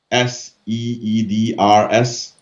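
letter by letter, slowly: S E E D R S; spelling it out. Shown as a demonstration. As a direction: spell S E E D R S one letter at a time. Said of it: "Can you spell it?".

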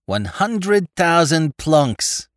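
slow, savoring each word; loving murmur. Acted. Spike gazing at the diamonds, loving murmur. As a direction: gloat over it lovingly. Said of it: "murmured Spike, gazing lovingly".

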